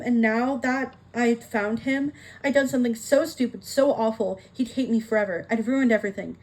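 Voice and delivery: ordinary delivery, nothing marked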